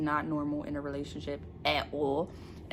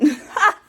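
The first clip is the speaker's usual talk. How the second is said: burst of laughter; 9 semitones higher, 14 dB louder than usual. Real, not acted. Celebrating book two done.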